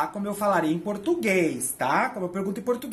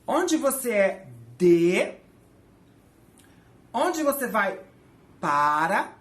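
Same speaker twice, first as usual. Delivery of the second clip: slowed, deliberate word-by-word gloss; teacher gloss pace; slow literal translation; 4 dB louder, much slower than usual. Shown as a demonstration. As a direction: read it out slowly as a literal rendering. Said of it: "literalmente pergunta assim".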